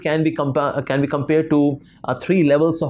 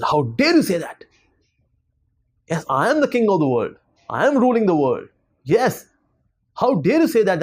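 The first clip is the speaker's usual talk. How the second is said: indignant, higher-pitched rant; mock royal outrage, not shouted; angry king; 4 semitones higher than usual. A narrator's reading. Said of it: "he became very angry".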